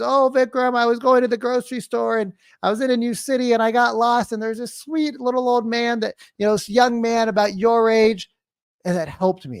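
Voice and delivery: high-voiced grandma recounting getting lost. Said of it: "my grandma that just got lost last week".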